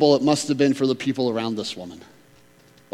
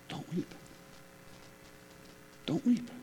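hushed, tender whisper; whispered comfort; 3 semitones higher, 18 dB quieter than usual. Acted. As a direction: breathe it gently to a grieving mother. Jesus speaks softly to widow.

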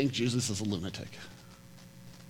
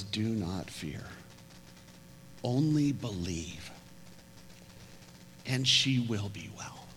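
slow, low, soothing promise; steady him with quiet calm; calm reassurance; 3 semitones lower, 8 dB quieter, slower than usual. Retold or imitated. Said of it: "he looks at Jairus and he says".

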